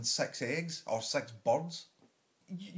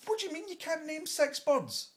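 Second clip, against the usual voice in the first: high-pitched exasperated disbelief; incredulous retort; 11 semitones higher than usual. Acted. Comic answering a contestant who can't name birds, incredulous. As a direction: throw it back as an outraged question.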